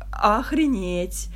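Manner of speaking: drawn-out, stunned reaction; retold amazement. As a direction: draw the word out in mock astonishment. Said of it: "Просто с таким, типа".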